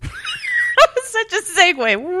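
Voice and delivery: slow, high laughing remark; amused slow high aside. Real, not acted. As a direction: laugh it out slowly. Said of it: "That was a weird Jerry Seinfeld joke".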